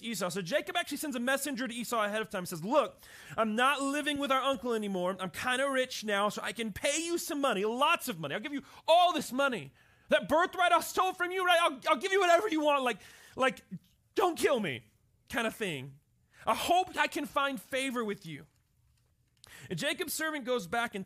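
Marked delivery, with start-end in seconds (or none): 2.6-14.8 high, loud, rushing plea; pleading Jacob. Retold or imitated. 16.5-18.5 pleading hope. Retold or imitated. Jacob's plea.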